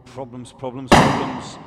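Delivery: building from a groan to a loud exasperated burst. Real, not acted. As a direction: let the frustration boil over by the last one. Sniper's rifle failing on air.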